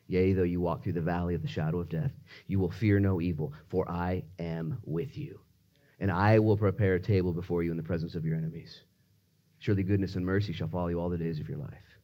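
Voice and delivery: low, soft voice of God; hushed, low reassurance; solemn scripture recitation. Acted. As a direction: answer the plea quietly and steadily. God's answer as quoted scripture.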